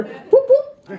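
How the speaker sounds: very high whoop; high mock siren; siren imitation. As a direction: mimic a police siren.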